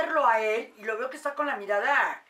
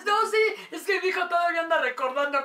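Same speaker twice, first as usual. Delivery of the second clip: laughing; 7 semitones higher, 6 dB louder, faster than usual. Real, not acted. Laughs while telling.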